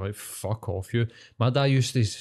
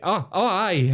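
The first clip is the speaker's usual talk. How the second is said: retold younger self; 8 semitones higher, slower than usual. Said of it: "I was just like".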